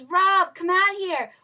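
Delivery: loud, high mock summons; high, shouted pretend call. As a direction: imitate a producer hollering for someone backstage. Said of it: "He goes".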